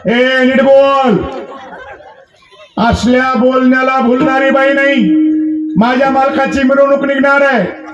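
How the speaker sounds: indignant rebuff. Acted. Wife refuses the supposed impostor at the door, indignant rebuff. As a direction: hold each line on a sustained note, warning him off.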